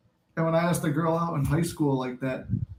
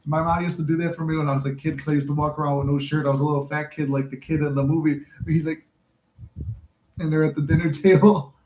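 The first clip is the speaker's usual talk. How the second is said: normal talking voice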